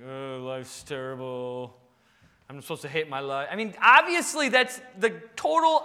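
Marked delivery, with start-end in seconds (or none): 0.1-3.5 low, moping mock lament; melancholy Eeyore. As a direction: groan it out like a gloomy, defeated sad sack. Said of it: "melancholy Eeyores".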